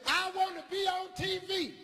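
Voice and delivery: put-on high, eager shout of a kid; proud kid answer. Boy announcing his dream to the teacher, eager shout.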